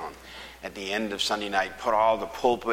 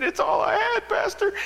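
very high, loud crying imitation; tearful man; 6 dB louder than usual. Man showing empty pockets to pastor, high tearful pleading. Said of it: "he was just a-crying".

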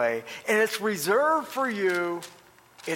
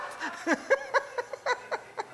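long, high-pitched laugh; laughter; 4 dB quieter than usual. Real, not acted. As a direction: laugh at your own story. Laughing at wife's retold remark.

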